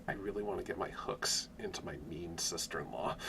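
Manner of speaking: low, hushed scheming aside; conniving schemer. Acted. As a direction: plot it quietly, under your voice. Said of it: "really specifically conniving and scheming sort of way".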